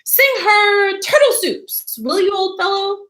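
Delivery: haughty, offended humph. Acted. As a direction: sniff at her taste and sulk. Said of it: "in rather an offended tone".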